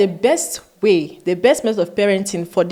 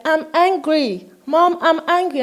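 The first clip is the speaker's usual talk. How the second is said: put-on child voice; 8 semitones higher than usual. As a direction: play the sulky kid telling mom. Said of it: "the child comes and is like".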